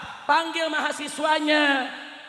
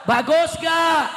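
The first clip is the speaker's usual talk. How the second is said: higher, louder, drawn-out call; rallying shout; 4 semitones higher, 6 dB louder, much slower than usual. Real, not acted. Preacher asks the crowd to approve peace, rallying shout.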